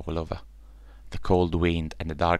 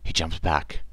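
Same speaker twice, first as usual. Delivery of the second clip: ordinary delivery, nothing marked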